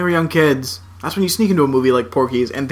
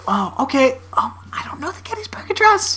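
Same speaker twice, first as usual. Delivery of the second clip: put-on much higher, sheepish boy voice; meek kid voice; 9 semitones higher than usual. Danny admitting to Wesley he doesn't know it, high sheepish. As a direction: squeak it out like a nervous kid. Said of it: "And he's like".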